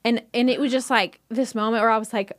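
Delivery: ordinary delivery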